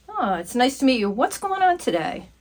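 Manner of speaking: ordinary delivery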